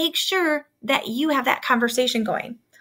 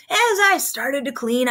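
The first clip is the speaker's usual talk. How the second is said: ordinary talk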